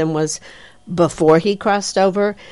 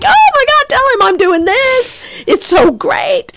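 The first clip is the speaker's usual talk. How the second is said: very high, louder, giddy delight; thrilled gushing; 3 dB louder than usual. Retold or imitated. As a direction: squeal it like a starstruck fan. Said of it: "And he was like".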